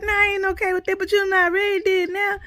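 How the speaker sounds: high, loud exasperated protest; held-back yell. Retold or imitated. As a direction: retell an outburst, barely keeping it from a yell. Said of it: "I was trying not to yell at him".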